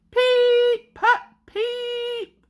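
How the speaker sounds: high, loud whistle peeps. A narrator's reading. Sir Handel warning George at the track, high whistle toots. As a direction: toot like a train whistle, sharp and high. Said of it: "Sir Handel whistled loudly".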